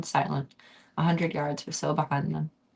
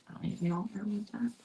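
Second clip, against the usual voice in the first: quick mutter; 4 semitones higher, 8 dB quieter, faster than usual. Real, not acted. Reacting to the cough.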